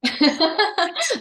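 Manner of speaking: high rippling giggle; bubbling, high-pitched chuckle; amused laugh. Real, not acted. Teacher amused by student's attempt.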